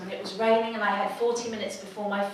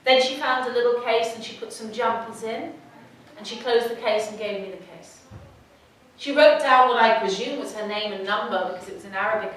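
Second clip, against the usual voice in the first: usual speaking voice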